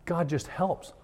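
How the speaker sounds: ordinary delivery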